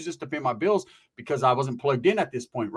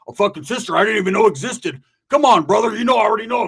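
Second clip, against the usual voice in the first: loud, raised pitch, exasperated outburst; incredulous rant; 5 semitones higher, 5 dB louder than usual. Retold or imitated. Friend ranting about a surprise sister in Hawaii, incredulous. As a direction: build into a loud, pleading complaint.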